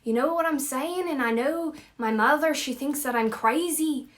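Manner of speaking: Scottish like a character from Brave; Scottish accent. Shown as a demonstration. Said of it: "think of Brave".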